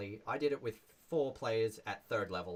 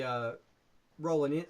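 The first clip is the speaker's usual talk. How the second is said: ordinary talk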